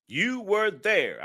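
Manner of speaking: pressing the point home; slow emphatic. Real, not acted. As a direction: lean hard on each word of "You were there". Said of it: "repeats for emphasis".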